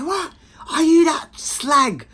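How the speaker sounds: put-on high, loud, slow confrontational girl voice; mock hostile girls. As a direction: play the girls squaring up. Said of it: "they see my girl and say".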